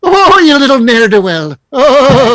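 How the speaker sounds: high, loud, hammed-up Irish brogue; mock Irish accent. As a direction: tease in an exaggerated Irish accent. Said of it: "supposed to be an Irish accent".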